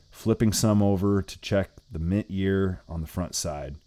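ordinary delivery

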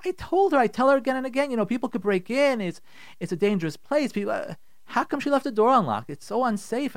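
high, agitated rant; acted angry husband. Husband upset his wife left door unlocked, angry. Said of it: "This guy is so angry".

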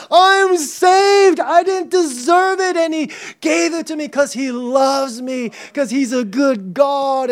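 high, loud jubilation easing gradually down; overjoyed. Acted. Preacher acting out joy of salvation, jubilant cry. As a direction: proclaim it bursting with joy, then settle. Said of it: "the other way to respond to this is joy".

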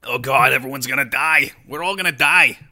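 loud hammed-up cry of doom; mock panic. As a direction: yell it like a doomed movie extra. Acts out panicking movie crowd.

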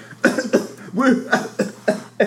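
harsh cough from a cold; real coughing fit; sick cough. Real, not acted. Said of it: "I have a really bad cold".

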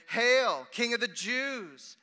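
high, ringing hail; loud acclamation. A narrator's reading. Soldiers saluting the man in thorns, loud hail. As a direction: call it out long and loud.

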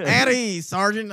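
hammed-up drill sergeant; soldier impression. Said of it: "soldier impression I can do".

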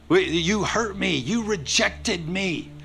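mock vengeful grudge. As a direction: play the wounded person plotting payback. Preacher voicing a vengeful person.

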